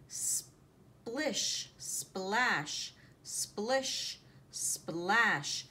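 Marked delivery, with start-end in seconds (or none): none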